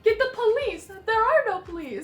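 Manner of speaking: bumbling townsman losing his nerve; flustered little man. Retold or imitated. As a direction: play a helpless little man in a panic. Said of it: "the guy's like".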